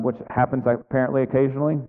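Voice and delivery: usual speaking voice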